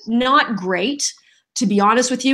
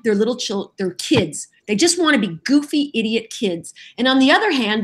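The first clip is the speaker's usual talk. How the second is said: ordinary talk, nothing marked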